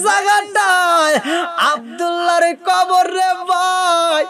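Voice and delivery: wailing narration. A narrator's reading. Preacher tells of reaching the grave, wailing chant. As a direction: stretch each phrase into a lament.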